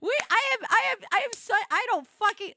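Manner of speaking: high, loud flustered stammer. Real, not acted. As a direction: stumble over your words, loud and worked up. Flustered rant about a friend's teasing.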